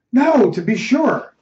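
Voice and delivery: high, slow, emphatic answer; agitated denial. Acted. Ernest answering his friend's questioning, agitated. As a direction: draw out the denial, worked up. Conceding point while agitated.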